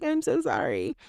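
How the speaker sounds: high, breathy mock-tearful apology; hammed-up weepy sorry while fanning the face; dramatic fake-teary sorry. Shown as a demonstration. Mocking a toxic apologizer with fake tears. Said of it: "cue the tears... the hands fanning your face".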